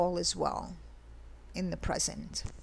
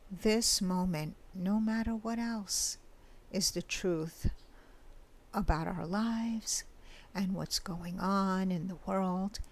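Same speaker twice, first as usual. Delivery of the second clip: guided meditation. Acted. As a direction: guide the listener gently into the present moment. Leads a mini meditation.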